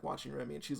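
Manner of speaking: ordinary delivery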